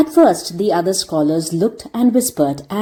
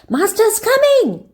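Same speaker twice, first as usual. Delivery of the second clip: high, slow call from up a tree; boy shouting a warning. A narrator's reading. Boy calling from a treetop to warn the others, shouting. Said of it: "Jimmy Glover shouting from the top".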